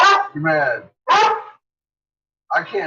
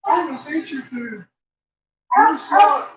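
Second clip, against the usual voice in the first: voicing the dog; 11 semitones higher than usual. Owner voicing her dog nagging Seamus, high. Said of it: "That's all I'm hearing".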